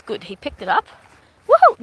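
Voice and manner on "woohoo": high whooping cheer. Real, not acted. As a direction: whoop for the dog. Cheering the dog.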